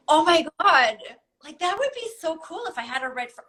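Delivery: acting out childlike, wide-eyed excitement; excited daydreaming. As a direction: gasp and gush like a thrilled kid. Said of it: "so excited about it".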